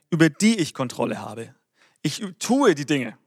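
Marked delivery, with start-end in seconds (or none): 2.0-3.2 "tue" drawn out with strong stress; slow down and punch "tue"; Betonung auf tue. Shown as a demonstration. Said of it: "Betonung ist hier auf ich tue". („tue“ langsam und kräftig betont)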